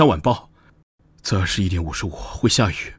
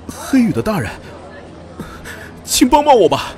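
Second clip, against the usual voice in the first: desperate plea; 12 semitones higher than usual. Acted. 陌生人向黑羽大人求救，惊慌高声哀求. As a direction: throw yourself on their mercy. Stranger begs guards for help.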